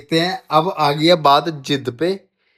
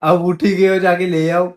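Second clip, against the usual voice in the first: louder, higher-pitched urging; insistent; 3 semitones higher, 6 dB louder than usual. Real, not acted. Husband urging wife to fetch it herself, insistent. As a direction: push back with raised voice. Arguing over who fetches blanket.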